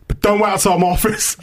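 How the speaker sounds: loud, high-pitched telling-off; exasperated call. Retold or imitated. Tutor calls out a telling-off.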